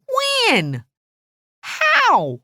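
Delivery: high, wailed one-word questions; high-pitched, upset demand; shocked questioning. A narrator's reading. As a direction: cry out in disbelief. Alice reacts to confession.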